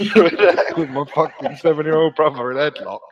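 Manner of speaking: real laughter breaking through, pitch climbing; laughing while talking. Real, not acted. Cracking up while telling it.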